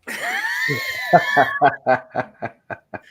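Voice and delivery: very high, shouting cackle. Real, not acted. Laughing hard at the pronoun prank story. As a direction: howl with laughter.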